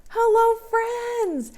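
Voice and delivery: squeaky-high puppet greeting; Betsy Blackbird puppet. Pretend. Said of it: "Come on out, Betsy Blackbird".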